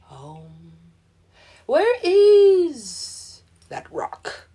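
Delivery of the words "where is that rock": slow searching. Real, not acted. Slow drawn-out odd voice.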